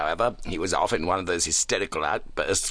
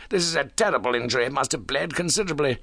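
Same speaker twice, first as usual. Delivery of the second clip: fast, higher-pitched alarm; alarmed cry; 7 semitones higher, faster than usual. A narrator's reading. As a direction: exclaim urgently over the injury. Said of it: "I cried".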